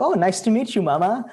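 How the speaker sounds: put-on high, innocent toddler voice; little kid voice. Retelling how as a toddler he greeted his dad, in a high little kid voice. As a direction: squeak it out like a two-year-old.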